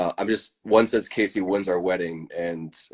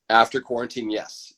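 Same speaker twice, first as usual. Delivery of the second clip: ordinary talk, nothing marked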